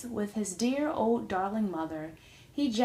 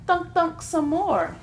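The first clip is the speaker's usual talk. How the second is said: slow strained cry; 6 semitones higher, 4 dB louder than usual. A narrator's reading. Little girl straining, slow loud cry. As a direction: cry it out slowly, straining with all her might. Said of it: "she cried".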